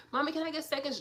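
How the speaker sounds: lightly voiced child begging for seconds; child asking. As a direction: ask like a hopeful child. Voicing her child asking.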